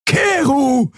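high, drawn-out cry of alarm; exclaiming. A narrator's reading. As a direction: call the name out in protest. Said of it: "rief Xea aus".

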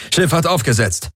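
high-pitched alien soldier reporting in; Golwone over comm. A narrator's reading. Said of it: "meldete eine Golwonenstimme über Comm".

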